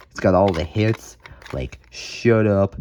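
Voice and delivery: ordinary delivery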